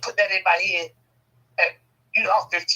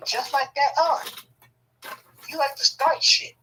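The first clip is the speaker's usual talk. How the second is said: needling jab; 9 semitones higher, slower than usual. Retold or imitated. Repeating the jab at sister.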